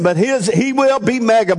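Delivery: ordinary delivery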